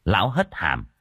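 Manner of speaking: ordinary delivery, nothing marked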